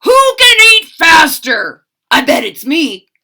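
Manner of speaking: loud, high, eager challenge; gleeful shout. Acted. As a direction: shout it with glee across the table. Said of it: "Pig shouted with glee".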